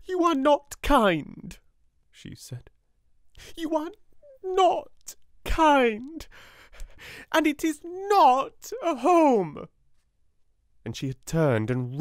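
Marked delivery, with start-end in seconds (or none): 0.0-1.6 much higher, louder, slow fierce accusation; fierce beyond her years; girl fiercely accusing headmistress; unchildishly fierce. A narrator's reading. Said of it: "spoke in a strange, unchildishly fierce way". 3.6-9.7 much higher, louder, slow fierce accusation; fierce beyond her years; girl fiercely accusing headmistress; unchildishly fierce. A narrator's reading. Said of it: "spoke in a strange, unchildishly fierce way".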